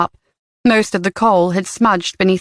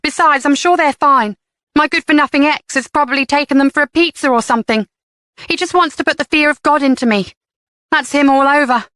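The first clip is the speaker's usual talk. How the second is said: defensive; 6 semitones higher than usual. A narrator's reading. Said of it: "Jenny said defensively".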